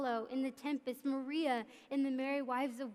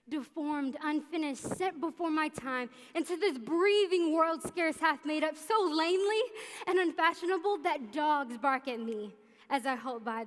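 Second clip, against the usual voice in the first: building outburst; 3 semitones higher, 6 dB louder than usual. Acted. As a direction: let the bitterness swell to a shout. Monologue builds to bitter outburst.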